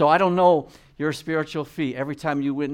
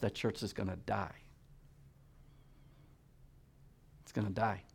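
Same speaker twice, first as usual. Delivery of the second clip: hushed grave warning; 4 semitones lower, 14 dB quieter than usual. Real, not acted. Grave warning about dying church.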